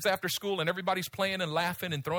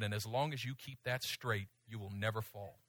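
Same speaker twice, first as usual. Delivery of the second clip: quiet promise; 9 semitones lower, 10 dB quieter than usual. Retold or imitated. God's quiet promise continues.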